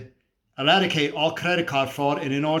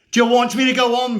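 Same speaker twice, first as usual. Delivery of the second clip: raised, louder confrontational demand; higher and louder, mid-rant; heated challenge; 7 semitones higher, 6 dB louder than usual. Acted. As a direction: throw it at the listener as a challenge. Challenges listener at rant's peak.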